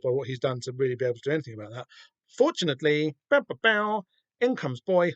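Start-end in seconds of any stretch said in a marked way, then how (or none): 3.3-4.1 high, loud bang bang sound effects; mock gunshot noises. Fan recapping the shootout with mock gunshots. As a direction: fire off cartoon gun noises.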